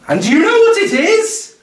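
high, loud singsong condescension; patronising photographer. Retold or imitated.

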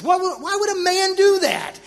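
high, emphatic mock disbelief; mocking judgment. Selling a joke to spiritual giants at breakfast, mocking. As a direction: scoff loudly, pitch climbing as you go. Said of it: "I'm judging them mercilessly".

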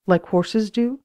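ordinary delivery

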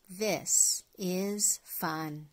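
ordinary delivery

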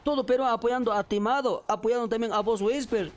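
rally the player like an impassioned fan; Bolivian beaver pep talk. Acted. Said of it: "es el castor boliviano apoyando a Whisper".

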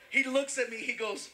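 ordinary talk, nothing marked

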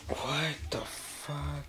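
slow, soft, creeped-out murmur; spooked murmur. Acted. Man alone in his basement hearing noises, slow spooked murmur. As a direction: barely breathe it out, unnerved.

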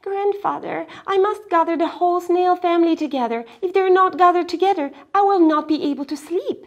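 put-on high, loud, fast child; urgent girl. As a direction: plead in a rush. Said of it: "Miyuki pleading".

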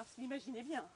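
ordinary delivery, nothing marked

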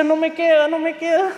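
fretting to oneself, mildly acted; worried. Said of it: "Yo estaba preocupado".

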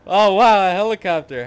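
loud high exclamation; excited. Real, not acted. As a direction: burst out amazed. Sudden excitement at a helicopter.

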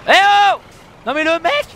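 loud, high outraged call; indignant yell. Real, not acted. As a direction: shout at the other driver. Yelling at reckless driver.

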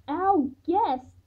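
high, loud disbelief; incredulous. A narrator's reading. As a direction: echo it in disbelief. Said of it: "I asked incredulously".

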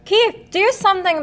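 very high, loud frightened plea; high loud urgent cry. Acted. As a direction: beg him from up the tree. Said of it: "they cried".

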